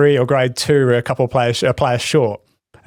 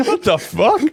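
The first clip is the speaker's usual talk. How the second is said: laughing disbelief; 7 semitones higher, slower than usual. Retold or imitated. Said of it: "I'm like".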